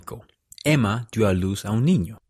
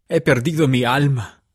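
higher, anguished outcry of despair; anguished exclamation; 4 semitones higher, 4 dB louder than usual. A narrator's reading. Martin confessing his loss to the family, anguished exclamation. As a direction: cry it out in despair, pitched up. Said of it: "exclamó".

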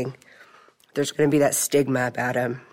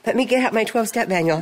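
eager know-it-all; 10 semitones higher than usual. Retold or imitated. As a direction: burst in like a keen student. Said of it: "and I'm like".

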